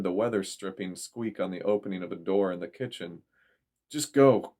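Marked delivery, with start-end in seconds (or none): 3.9-4.6 loud, pressing command; urgent shout. A narrator's reading. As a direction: push them away from danger. Intruder entering the kitchen.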